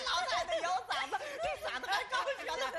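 words pushed out through laughter, pitch climbing; laughing. Real, not acted. Host laughing at the old lady's story, laughing through the words.

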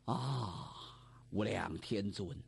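put-on low, slow old priest's chant-like sigh; low drawn-out Taoist blessing; old Taoist invocation. A narrator's reading. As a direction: intone it like a weary old Taoist. Old Taoist sighs his invocation.

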